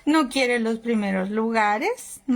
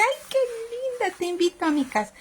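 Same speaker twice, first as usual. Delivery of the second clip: much higher, fast, gushing flattery; hammed-up sugary invitation; mock fawning gush; 11 semitones higher, much faster than usual. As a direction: coo over the pretty guest like a flatterer. Mimicking flattering worldly person.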